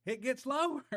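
high laughing punchline, the voice jumping up. Real, not acted. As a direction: laugh it out as the twist lands. Laughing at movie-like surprise.

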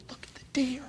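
urgent hushed whisper to his sister. Retold or imitated. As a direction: whisper so the deer won't bolt. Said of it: "it's a whisper shout".

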